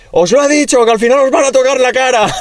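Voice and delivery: very high mock shouting; high-pitched, hammed-up scolding; retold angry yell. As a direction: imitate a driver yelling from a van. Van driver yelling from window.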